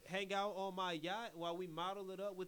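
loud, cocky rich-guy swagger; cocky playboy. Retold or imitated. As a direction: brag like a sleazy billionaire. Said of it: "he's billionaire playboy".

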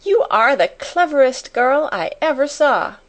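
high, admiring outburst; delighted cry. A narrator's reading. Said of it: "she cried out".

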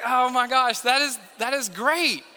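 mock-delighted reaction; amused delight. Father reacts to son's answered prayer, amused and excited. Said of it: "I was like".

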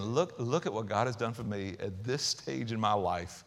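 low, soft and grateful; tender, soft. Retold or imitated. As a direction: say it softly, with gratitude. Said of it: "she's saying".

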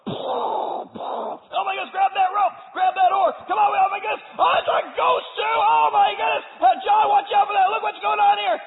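high, loud frantic yelling; panicked shouting. Acted. Disciples in the storm shout to each other in panic. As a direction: scramble and shout over the storm.

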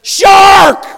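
high, very loud warning yell; alarm shout. Acted. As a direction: shout it to clear the water. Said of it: "Nobody goes, "Shark".

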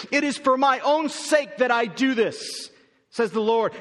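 high, loud, hammering each not; emphatic. Acted. Preacher making it emphatically clear to Israel, hammering each word. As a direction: press the point home hard. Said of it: "Let me make this emphatically clear".

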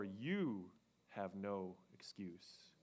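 slow whispered verdict; whispered, heavy and slow; solemn whisper. Acted. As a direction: hush it like a sentence handed down. Paul drops the hammer.

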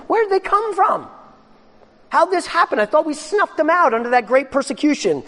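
high, bewildered voice of the persecutors; baffled opponents. Retold or imitated. Opponents of Christianity wondering where Christians came from, high bewildered. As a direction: squeak it out in disbelief. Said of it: "just wondered".

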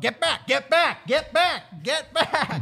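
high, repeated urgent shouting; hammed-up high cop yell; mock cop commands. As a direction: imitate a panicked cop barking orders. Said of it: "most real cop moment".

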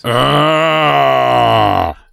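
hammy zombie groan into a laugh; zombie moan. Pretend. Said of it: "That's my zombie impression".

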